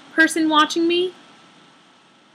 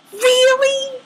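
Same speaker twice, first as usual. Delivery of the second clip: exasperated; 8 semitones higher, 3 dB louder than usual. Retold or imitated. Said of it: "And I'm like".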